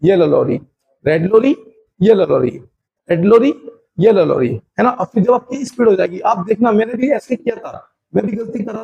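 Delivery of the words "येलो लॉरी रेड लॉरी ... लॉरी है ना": each lorry phrase punched louder; teacher drilling the tongue twister loudly. Shown as a demonstration. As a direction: drill it loud and rhythmic. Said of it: "but आपको बोलना है रेड lorry".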